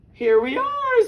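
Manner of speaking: high, loud arrival call; theatrical storybook fox; triumphant fox. A narrator's reading. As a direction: announce it grandly as the fox. Fox arrives at his den.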